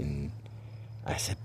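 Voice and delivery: ordinary delivery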